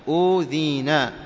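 أوذينا slowly, with a drawn-out "oo"; slow long-vowel أوذينا; madd vowel. Shown as a demonstration. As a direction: hold the first vowel of أوذينا, no catch. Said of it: "وكذلك ما يماثلها".

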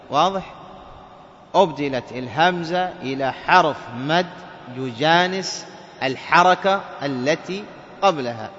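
ordinary delivery

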